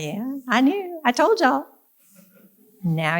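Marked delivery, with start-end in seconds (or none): 0.0-1.7 high-pitched, mock-proud I-told-you-so; playful boasting. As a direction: brag in a higher voice, owning it playfully. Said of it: "I'm just, I'm gonna own that. I'm gonna be like".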